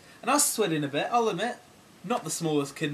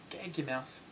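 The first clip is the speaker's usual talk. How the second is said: muttered under breath; 3 semitones lower, 11 dB quieter than usual. Retold or imitated. Quieter repeat.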